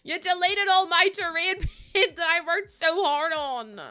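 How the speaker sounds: loud, high, drawn-out wail of complaint; mock-wailing outrage. Real, not acted. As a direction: cry out in half-laughing outrage at the game. Mock outburst over deleted game paint.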